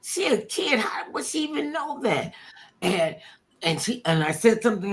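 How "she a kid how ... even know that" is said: high-pitched baffled wondering; puzzled thought. Retold or imitated. Star wondering how a kid knows her, puzzled. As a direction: think it aloud, high and puzzled. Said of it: "And I'm thinking".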